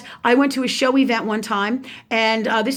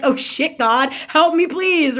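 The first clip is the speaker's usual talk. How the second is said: higher, lightly panicked plea; mock desperate plea; 5 semitones higher, 4 dB louder, slower than usual. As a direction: plead for help, half in jest. Retold plea when yelled at.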